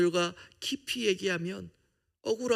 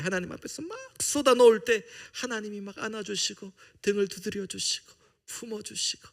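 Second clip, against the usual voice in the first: normal talking voice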